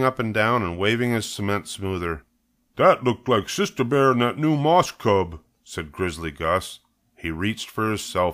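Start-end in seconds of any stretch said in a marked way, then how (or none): 2.8-5.4 higher, loud, indignant character voice; angry workman. Acted. Workman waving cement smoother spots cubs, angry. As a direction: fume at the culprits.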